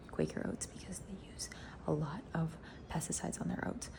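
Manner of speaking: hushed, breathy whisper about the brand; conspiratorial whisper; whispered aside. Real, not acted. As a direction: lean in and whisper it like a secret.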